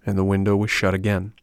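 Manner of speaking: ordinary delivery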